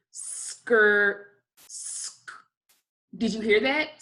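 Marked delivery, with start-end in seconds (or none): 0.1-2.3 "Skr-" drawn out, then bare "sk-"; slow sounding out. Shown as a demonstration. Teacher helping students hear the next sound, slowly. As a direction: hold the s, then tap the k. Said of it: "say it slowly again".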